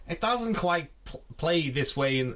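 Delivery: high put-on voice, cut off; first stab at sounding like Tarantino; Tarantino impression. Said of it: "try and do my best Tarantino impression".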